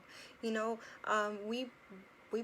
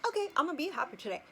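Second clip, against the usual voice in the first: higher, louder, fast fake-bright resolve; mock cheerful; 7 semitones higher, 5 dB louder, much faster than usual. Mocking the idea of waking up happy, fake-cheery. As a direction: chirp it like forced instant happiness. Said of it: "and just be like".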